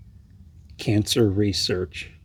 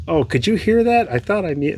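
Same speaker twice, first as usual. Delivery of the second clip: high-pitched imitation of embarrassed surprise; caught-out surprise; 7 semitones higher, faster than usual. Friend caught throwing up on the phone, mock surprised. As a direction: pitch leaps up on being found out.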